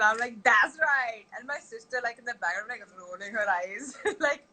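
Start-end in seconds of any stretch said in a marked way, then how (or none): 0.4-1.2 higher, louder mock triumph; playful hearty approval. Aunt confirming twin game with niece, playfully emphatic. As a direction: confirm it proudly, hammed up for a child.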